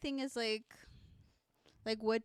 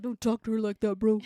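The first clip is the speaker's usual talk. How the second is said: louder, firm defender voice; tough guy voice; 9 dB louder than usual. Retold or imitated. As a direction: play the protective friend stepping in. Ransom defends her in retelling.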